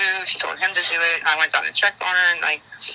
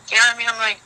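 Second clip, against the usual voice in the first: ordinary talk, nothing marked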